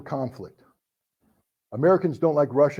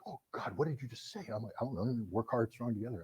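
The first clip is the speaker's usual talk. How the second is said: hushed confusion; 5 semitones lower, 17 dB quieter than usual. Retold or imitated. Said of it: "the Americans are just like".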